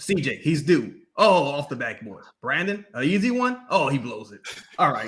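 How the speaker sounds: low, deflated play-by-play; Joel Myers impression. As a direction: imitate an announcer giving up on the team. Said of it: "He's like this".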